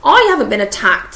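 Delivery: loud, firm denial, pushed hard; emphatic. Acted. Hagrid to the friends, an emphatic loud denial. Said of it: "said Hagrid emphatically".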